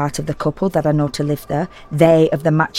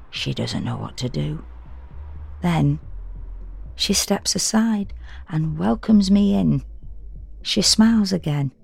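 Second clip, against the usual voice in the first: usual speaking voice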